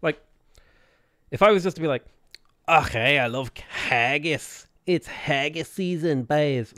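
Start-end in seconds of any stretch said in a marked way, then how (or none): 2.7-6.7 awful Scottish accent. Shown as a demonstration. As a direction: draw it out in a terrible Scottish accent. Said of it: "some awful Scottish accent".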